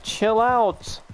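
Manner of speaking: high, exasperated chill out; a put-on high retort; retort to a friend. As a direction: brush him off in a high voice. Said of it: "I'm like".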